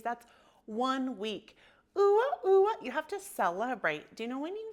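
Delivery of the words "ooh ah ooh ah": high, loud playful oohs and ahs; celebratory cheer. Real, not acted. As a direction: whoop it up for the milestone. Celebrating one week of practice.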